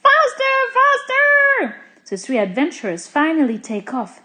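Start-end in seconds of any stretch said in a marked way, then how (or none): 0.1-1.8 high, loud, stretched cries; frantic drawn-out shout; urgent yelling. A narrator's reading. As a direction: yell to hurry them along. Urging friends to escape lion.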